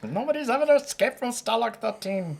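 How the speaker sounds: high-pitched, bad German accent; terrible German accent. Fan quoting Hogan's Heroes to friends, terrible German accent. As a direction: do a hammed-up, badly faked German accent. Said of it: "worst German accent I've ever heard".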